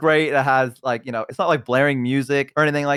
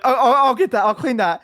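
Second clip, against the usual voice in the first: higher, louder hasty offer; imitated awkward helper; 8 semitones higher, 4 dB louder than usual. Kissed guy offering to clean the litter box, imitated awkward helper. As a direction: play someone sheepishly volunteering to clean.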